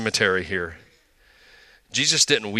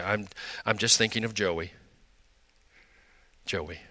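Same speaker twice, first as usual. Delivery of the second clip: tearful mother; 6 dB quieter than usual. Retold or imitated. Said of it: "sometimes she would just cry".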